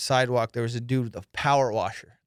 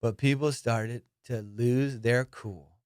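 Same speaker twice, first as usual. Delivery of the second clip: usual speaking voice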